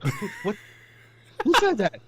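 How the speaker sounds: startled driver hearing a voice from nowhere. Retold or imitated. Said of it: "I nearly jumped and crashed".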